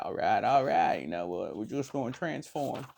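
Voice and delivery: lightly voiced surfer robot, laid back; Matthew McConaughey impression. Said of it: "Matthew McConaughey's voice".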